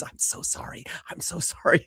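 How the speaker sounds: unvoiced whisper, barely there; mouthed apology. [name] apologising outside the audition room, whispered. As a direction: mouth it with only breath behind it. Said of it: "is mouthing the words".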